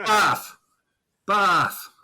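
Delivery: "Bath" high and loud, broad Boston "bahf"; Boston accent. Retold or imitated. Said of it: "They say bath".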